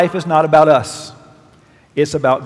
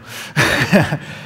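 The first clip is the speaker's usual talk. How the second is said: breathy laugh. Real, not acted. Laughing at own joke.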